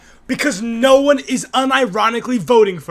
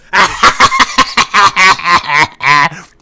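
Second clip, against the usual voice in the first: big, loud burst of cackling; loud laugh; 3 semitones lower, 9 dB louder than usual. Real, not acted. Viewer hearing the sarcasm excuse, loud cackle. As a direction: laugh hard and loud in a long run.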